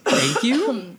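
mock gracious thank you; sarcastic thanks. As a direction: play it overly polite. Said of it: "I'm just like".

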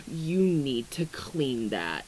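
low, slow, a hint of scolding; stern scolding voice. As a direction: scold her calmly. Voicing judgmental friend.

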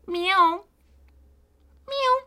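very high playful meow; cat meow. Pretend. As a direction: meow like a kitten. Meows after Kitty Girl.

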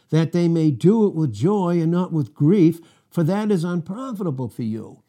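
scripture reading. Acted. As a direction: lift the verse up and read it out with weight.

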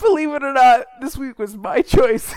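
high and loud, laughing through it. Real, not acted. Host laughs about picking this week's movie. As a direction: giggle out the confession.

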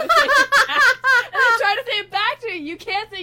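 high, loud talk through laughter; cracking up while explaining; helpless laughter. Real, not acted. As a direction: barely get it out for laughing. Cracks up explaining the y'all problem.

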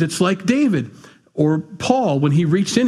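ordinary talk

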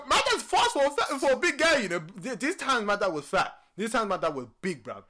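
ordinary talk

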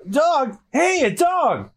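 high, excited exclamation; high-pitched mock astonishment; surprised discovery. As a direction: spot it suddenly and call out. Hero suddenly spots a dog.

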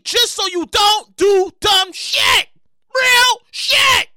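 shouting, slow and high; loud rant. Real, not acted. Host shouting about the star's recklessness. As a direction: hammer the point.

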